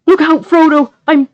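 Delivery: high, loud cry, cut off mid-word; desperate shout. A narrator's reading. Sam shouting to Frodo before a hand covers his mouth. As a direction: yell for him, then get stifled. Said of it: "gathered all his remaining breath to shout".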